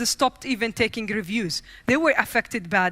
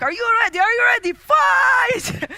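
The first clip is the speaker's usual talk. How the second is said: fight announcer; 10 semitones higher, 5 dB louder than usual. Retold or imitated. Imagined fight starting in her head.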